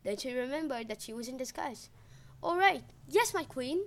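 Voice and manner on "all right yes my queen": lightly voiced old woman, higher; a hint of a humble old beggar woman; old lady voice. Demeter in disguise answers the queen, mild old lady voice. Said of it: "in her best old lady voice".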